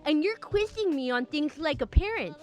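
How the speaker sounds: high, put-on lisping complainer; lisping date. A narrator's reading. Date complains to his driver, high lisping voice. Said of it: "that voice I just did".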